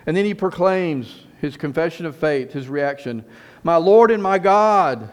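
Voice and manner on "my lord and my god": fervent proclamation. Acted. Thomas's confession voiced in sermon.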